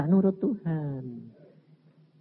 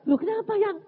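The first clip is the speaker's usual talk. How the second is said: high-pitched puzzled question; surprised wife; 3 dB louder than usual. Retold or imitated. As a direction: imitate a startled wife asking why. Istri kaget diajak pergi. (istri kaget, suara tinggi)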